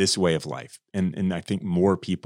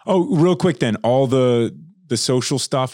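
higher, louder burst of remembering; sudden excited; 5 semitones higher, 5 dB louder, slower than usual. Real, not acted. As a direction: blurt it out suddenly. Host suddenly remembers plug.

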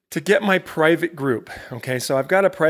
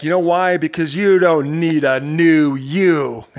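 slow, punchy coach line, louder, laughing; drawn-out knowing coach punchline; imitating Tim Grover; 6 dB louder, slower than usual. Host imitating coach Tim Grover delivering his punchline. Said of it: "This is the way Tim Grover talks".

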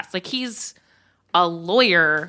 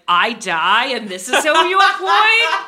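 put-on high, loud outraged old New Yorker; yiddish accent; 9 semitones higher, 5 dB louder than usual. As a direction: rant like a disgusted old mentor. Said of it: "he'd be like".

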